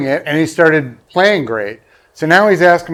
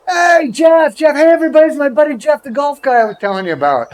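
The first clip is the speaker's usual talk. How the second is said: high, bright call across a room, greeting a buddy; excited call across the room; 10 semitones higher, faster than usual. Retold or imitated. Comedian hails his golf buddy across the backstage room, high excited call. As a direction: shout a high, happy hello from across the room.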